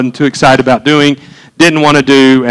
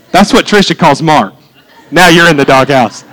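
ordinary talk, nothing marked

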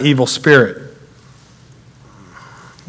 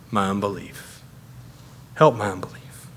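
low, soft appeal; hushed plea; 6 semitones lower, 14 dB quieter than usual. Acted. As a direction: let the plea drop almost to nothing. Father's humble plea, acted out.

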